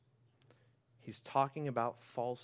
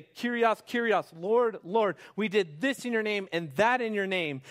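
pleading self-justifier; 8 semitones higher, 9 dB louder than usual. Retold or imitated. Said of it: "come to him and say".

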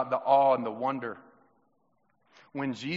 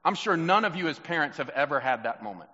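normal talking voice

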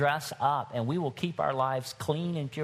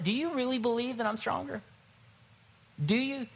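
lightly acted probing question, higher pitch; challenging Holy Spirit; 10 semitones higher than usual. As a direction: press the point with a quicker question. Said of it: "the Holy Spirit ... is like".

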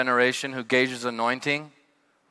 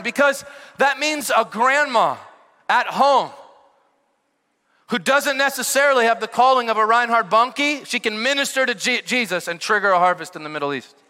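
fiery preaching; 8 semitones higher, 4 dB louder than usual. Acted. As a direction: drive the point home like a revival preacher. Preacher building to a peak.

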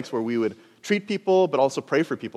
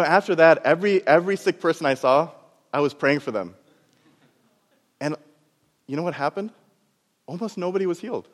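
ordinary talk

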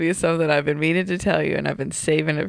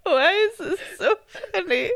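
laughing so hard it breaks into tears; helpless teary laughter; crying laughing; 11 semitones higher than usual. Real, not acted. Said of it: "I'm crying".

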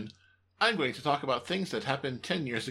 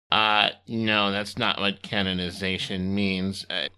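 deadpan assistant; 8 semitones lower, slower than usual. Acted. Timmy corrects.

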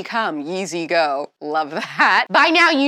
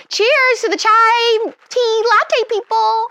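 cheery toast; 12 semitones higher, 4 dB louder, slower than usual. Real, not acted. Toasting with her drink.

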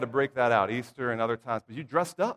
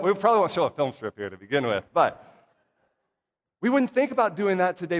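ordinary delivery

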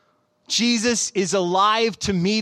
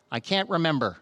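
quieter, lower imitation of a blank child; 4 semitones lower, 4 dB quieter than usual. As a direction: deliver it blankly, as a child's anticlimax. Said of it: "she looked blankly at me and said".

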